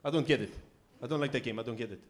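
lightly voiced puzzled immigrant cabbie; imitated cabbie complaint, falling in pitch; Bana. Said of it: "He's like".

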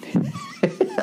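high squealing laugh; burst of laughter. Real, not acted. Comic cracking up at the stadium hype. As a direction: let a shrill laugh burst out.

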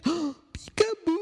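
very high, drawn-out delighted peekaboo; spring the surprise on the little ones; high peekaboo reveal. Acted. Reader revealing the cat to children, squealing high.

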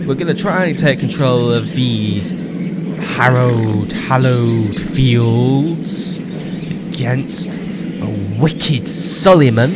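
slow, stretched-out mock-epic announcement; hammed-up epic teaser. Gamer teasing the next episode, mock-epic. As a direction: draw the words out like a trailer for a battle.

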